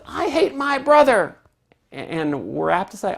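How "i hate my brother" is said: put-on high, loud child voice; a kid blurting out anger; a child complaining to a parent, high and loud; upset child. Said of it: "a child says".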